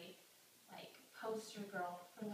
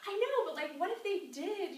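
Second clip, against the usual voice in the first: much higher, bright teasing challenge; mock-high hopeful nudge; playful push; 10 semitones higher, 7 dB louder than usual. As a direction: coax her, grinning. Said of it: "I'm like".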